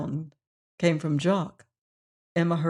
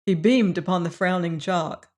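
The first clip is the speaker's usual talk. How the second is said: ordinary delivery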